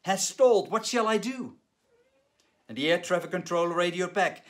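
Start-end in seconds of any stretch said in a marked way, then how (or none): 0.0-1.6 higher and louder, alarmed; panicked shout. Retold or imitated. Pilot reporting a stalled engine, loud alarmed shout. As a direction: shout the news to the tower.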